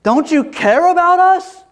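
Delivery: very high, desperate shouted plea; panicked yelling. Acted. Disciples yelling at sleeping Jesus in the storm, panicked yelling. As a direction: scream it as an accusing plea. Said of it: "They're yelling at Jesus".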